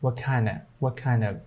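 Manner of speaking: "What kinda" softly as one word, T unreleased; silent T, linked. Shown as a demonstration. Teacher showing how they join "What kinda", silent T. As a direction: run "What" into "kind" with no T release. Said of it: "keep the T silent and make those two word".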